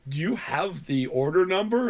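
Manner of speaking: imitated tech support questioner; over-enunciating. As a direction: ask it syllable by syllable, scripted. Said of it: "but he was over enunciating".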